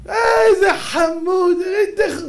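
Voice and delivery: high, slow, doting coo; mock a fawning parent cooing; drawn-out high mocking coo; gushing parent. Parents fawning over rude child.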